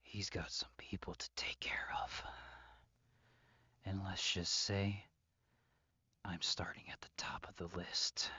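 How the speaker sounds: fast, hushed threat; menacing whisper. Acted.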